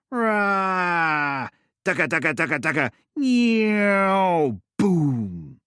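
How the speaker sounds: high, loud playful gun and boom noises; child's war noises. Acted. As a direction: play aeroplane gunner. Child playing aeroplane.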